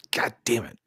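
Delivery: whispered curse under breath; frustrated whisper. Retold or imitated. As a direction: hiss it out in frustration. Voicing frustrated Rollins.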